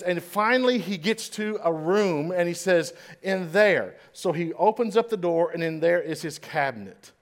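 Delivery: normal talking voice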